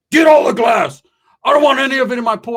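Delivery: high shouted orders; drill sergeant. Retold or imitated. Angry uncle ordering nephew to clean glass, drill-sergeant shout. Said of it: "all fucking drill sergeant".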